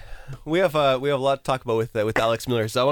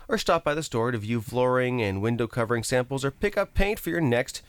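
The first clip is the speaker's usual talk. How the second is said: radio host read; 3 semitones higher than usual. Real, not acted. Host welcoming listeners back with a sponsor plug, radio read. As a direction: announce the segment like a radio host.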